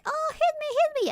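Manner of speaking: high mock plea; mock pleading. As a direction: squeal the plea mockingly. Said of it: "are always like".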